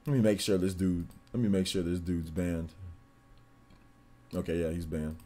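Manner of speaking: low, soft absent-minded aside; talking to oneself. Real, not acted. Streamer checks that the viewer is banned, soft aside to himself. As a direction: say it softly while busy checking.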